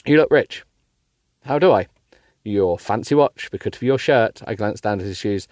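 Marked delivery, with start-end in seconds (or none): none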